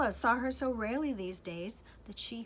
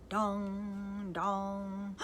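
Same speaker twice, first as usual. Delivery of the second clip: slow booming dong; clock chime. Acted. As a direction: toll it like a great clock. Clock strikes midnight.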